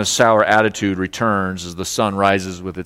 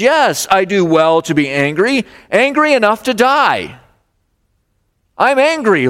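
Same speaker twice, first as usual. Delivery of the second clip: angry Jonah; 8 semitones higher than usual. Acted. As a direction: throw it back at God in anger. Jonah answers God angrily.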